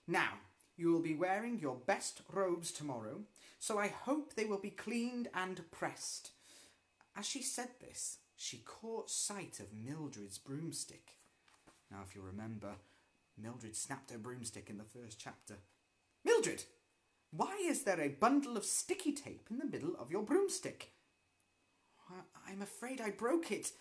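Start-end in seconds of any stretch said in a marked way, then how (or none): 0.1-6.3 stern teacher. Acted. Teacher lecturing the class. 16.2-20.9 much higher, loud demand; sharp rebuke. Acted. Miss Hardbroom rebuking Mildred over her taped broomstick, sharp and loud. Teacher catching a pupil's fault.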